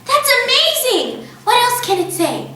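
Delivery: amazed. A narrator's reading. Matilda amazed at the parrot speaking, high and wondering. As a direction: marvel at the talking bird.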